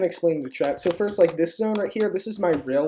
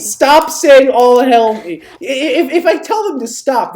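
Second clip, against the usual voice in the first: high, loud yell at the chat; exasperated yell; 6 semitones higher, 10 dB louder than usual. Real, not acted. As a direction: shout them down, fed up. Yelling at chat to stop.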